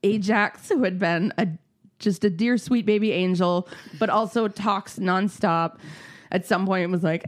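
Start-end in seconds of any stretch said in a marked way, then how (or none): none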